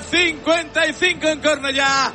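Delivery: ordinary delivery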